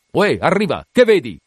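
loud hail up to the masthead; calling aloft. Acted. Captain hailing the lookout aloft, loud call. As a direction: bellow the question up the mast.

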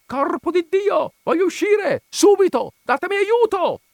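very high, loud screamed demands; trapped man bellowing at a high pitch; furious yell. A narrator's reading. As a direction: scream to be let out, enraged.

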